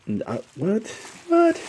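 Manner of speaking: a sudden high disbelieving what; stunned disbelief. Real, not acted. Unboxing a gift from Nate, high stunned disbelief. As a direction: shoot up in pitch, can't believe it.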